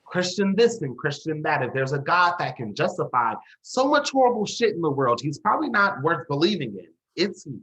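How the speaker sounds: heated, contemptuous outburst; bitter rant. Acted. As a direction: cut in and rail at the hypocrisy.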